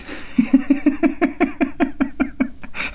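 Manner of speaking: long helpless wheezy laugh; real laughing. Real, not acted. Laughing helplessly after quoting the coworker's verdict. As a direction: crack up at your own story. Laughs at his own story.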